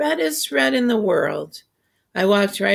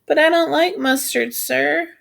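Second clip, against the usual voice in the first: high blurted yell; 8 semitones higher, 3 dB louder than usual. A narrator's reading. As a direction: blurt it out loudly, higher. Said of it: "my stomach made my mouth yell out".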